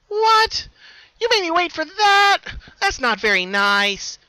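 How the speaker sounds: put-on a pouting child wronged; high loud hurt huff; huffy other Pinkie. A narrator's reading. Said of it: "giving a huff".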